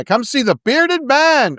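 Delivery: high, slow barker call; ringmaster. Acted. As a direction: call out to the crowd. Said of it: "he's the ringmaster".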